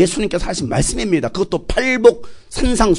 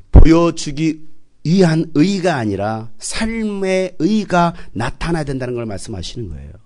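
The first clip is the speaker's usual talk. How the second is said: ordinary talk, nothing marked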